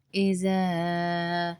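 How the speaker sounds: اذا with the ذ said softly, not as a hard z; اذا slowed, ذ kept gentle; soft zaal. Shown as a demonstration. Teacher shows soft ذ in اذا for students. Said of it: "نرمی سے پڑھیں گے".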